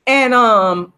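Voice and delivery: ordinary delivery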